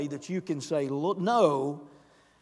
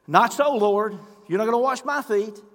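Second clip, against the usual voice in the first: raised, higher indignant refusal; Peter protesting; 5 semitones higher, 6 dB louder than usual. Acted. Peter refusing Jesus washing his feet, raised protest. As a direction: push back hard.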